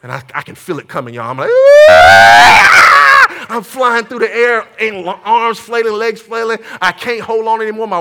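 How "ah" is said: long, loud comic yell; hammed-up scream of terror; mock terrified scream. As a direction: holler as if flung into the air. Screams as tube flies again.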